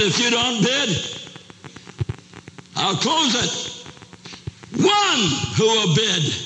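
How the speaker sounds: high-pitched, angry calling out; angry auctioneer. Acted. Angry auctioneer demanding bids from the crowd, high angry call. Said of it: "He was angry".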